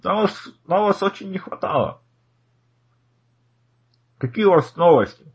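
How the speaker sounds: lightly voiced absent-minded scholar; delighted old professor.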